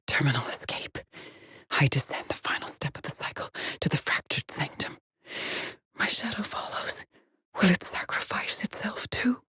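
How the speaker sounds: hushed, breath-only voice; ominous whisper. Acted. Explorer descending to the fractured sanctum, whispering. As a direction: whisper it as a dark confession.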